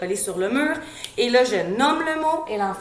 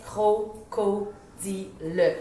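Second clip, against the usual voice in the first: CRO-CO-DI-LE chopped into four slow, separate syllables; "cro-co-di-le" read out slowly like a beginning reader decoding; 4 dB quieter, much slower than usual. Shown as a demonstration. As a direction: sound out "cro-co-di-le" haltingly, one syllable at a time. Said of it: "lu de manière saccadée".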